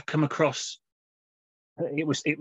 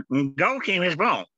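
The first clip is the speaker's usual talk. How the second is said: put-on slow, higher-pitched Scottish-accented call; mock Scottish accent; 5 semitones higher than usual. Host retrying the goalkeeper's call after laughing, mock Scottish accent. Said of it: "wasn't he Scottish?".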